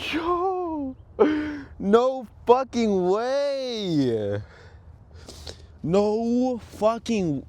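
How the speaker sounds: high, slow, drawn-out protest; stunned disbelief. Real, not acted. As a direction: stretch the words out in real dismay. Sees the kingpin snapped.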